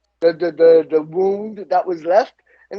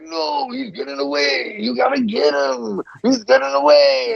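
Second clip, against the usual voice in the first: higher, mock-frantic pleading; frantic protest; 7 semitones higher than usual. Macho Man held back by cops, frantically urging them on. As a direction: imitate Macho Man straining against the cops.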